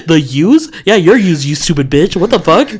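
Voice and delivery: loud high outraged rant through laughter; mock rage laughing. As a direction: yell it while cracking up. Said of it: "I'd be like".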